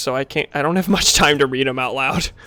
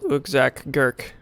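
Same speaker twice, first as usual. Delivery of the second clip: ordinary delivery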